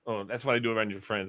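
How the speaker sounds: normal talking voice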